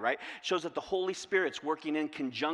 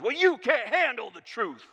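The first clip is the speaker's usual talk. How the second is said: loud, high courtroom outburst; Jack Nicholson impression; 6 semitones higher, 7 dB louder than usual. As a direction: fire it back at the attorney. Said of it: "Was that a great Jack Nicholson?".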